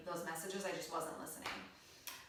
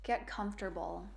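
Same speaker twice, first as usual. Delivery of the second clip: measured meditation voice with long pauses; guided meditation. Acted. Yoga teacher leading beginners into a body scan, calm guided meditation. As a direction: guide them calmly, phrase by phrase, leaving room to breathe.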